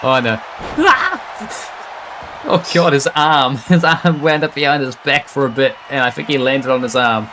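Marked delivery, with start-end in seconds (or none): 0.8-2.4 sudden high yelp of sympathy; sharp high cry at a nasty landing; pained yelp. Real, not acted. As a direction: yelp as if you felt that hit. Wince at a brutal move.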